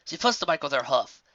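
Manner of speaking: ordinary delivery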